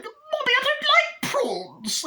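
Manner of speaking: put-on very high squeaky little-girl voice; mocking falsetto kid; whiny child. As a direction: complain in a tiny falsetto child voice.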